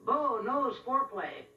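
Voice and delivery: high, slow, drawn-out quote; Doctor Ruth. Acted. Comedian quoting Doctor Ruth in a commercial, high slow impression. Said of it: "Doctor Ruth saying".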